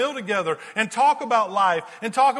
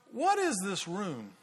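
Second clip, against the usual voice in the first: puzzled; 8 dB quieter, slower than usual. Retold or imitated. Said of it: "she goes".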